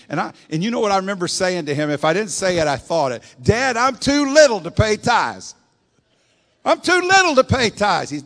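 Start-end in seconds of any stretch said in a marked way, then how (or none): 3.4-5.5 put-on high, loud, slow childish plea; pleading little boy. 6.6-8.1 put-on even higher, loud childish whine; pleading little boy.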